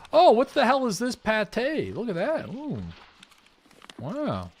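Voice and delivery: surprised. Real, not acted. Finding a can of pâté.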